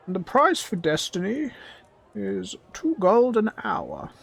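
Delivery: high, drawn-out goblin voice; unamused goblin. Acted. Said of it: "the goblin seems utterly unamused".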